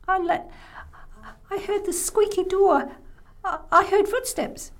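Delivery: put-on high, stammering child voice; frightened little boy. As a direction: stammer out what scared you. Scared boy explains himself.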